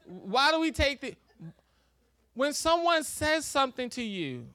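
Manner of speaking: ordinary talk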